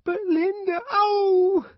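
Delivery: very high wail, breaking into a yelp; plead, then cry out from a slap; crying child's plea. A narrator's reading. Boy slapped while pleading.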